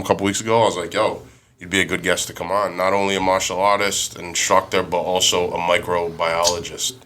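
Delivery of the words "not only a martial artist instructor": usual speaking voice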